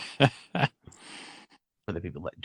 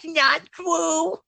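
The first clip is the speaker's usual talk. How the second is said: much higher, hammed-up whine; mock Luke wail; 13 semitones higher than usual. Friends riffing on the meme as a high Luke.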